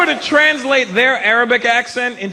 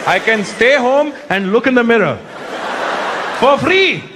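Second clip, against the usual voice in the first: grumbling Indian audience; 3 semitones higher than usual. Retold or imitated. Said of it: "That's the look on their face".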